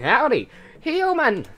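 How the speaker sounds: high, loud, slow goofy skeleton; Papyrus. Acted. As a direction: boom it like a clown skeleton. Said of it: "that's Papyrus".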